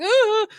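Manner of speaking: a sudden high, loud wailing sob, retold; imitated crying wail. Mother retelling how she broke down crying at the park, high loud wailing sob. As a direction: let out a mock cry of anguish. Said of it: "I just started crying".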